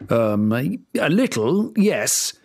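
king caught out by the uninvited fairy; nervous king. Acted. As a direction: stumble out a guilty answer. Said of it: "muttered the king nervously".

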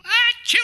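loud, high mock sneeze "ah-chew"; fake sneeze. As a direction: act out a big sneeze. Imitating a sneeze at the dock.

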